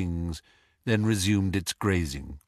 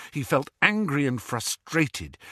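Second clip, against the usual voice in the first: ordinary delivery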